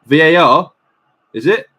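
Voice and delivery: loud, stunned questions; surprised outburst. Real, not acted. Fan reacting to the VAR check, loud and disbelieving. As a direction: blurt it out in disbelief.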